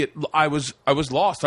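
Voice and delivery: normal talking voice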